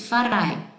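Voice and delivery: Farai with a strongly rolled R; strong rolled Italian R on Farai; exaggerated trill. Shown as a demonstration. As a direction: overdo the R in Farai so learners hear it. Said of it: "Eu tô forçando um pouquinho mais".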